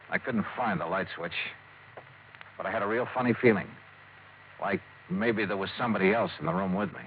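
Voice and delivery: suspenseful narration. Acted. The detective narrating as he creeps into the dark lodge in a hushed, tense voice. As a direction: draw the listener into the dark room.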